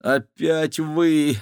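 slow, drawn-out mock groan. A narrator's reading. Maxim sees the villains again, weary groan. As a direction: drag it out in weary dismay. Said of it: "простонал Максим".

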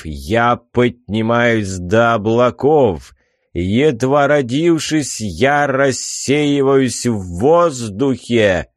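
slow, grand riddling sphinx voice; solemn proclamation. Acted. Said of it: "торжественно провозгласил сфинкс".

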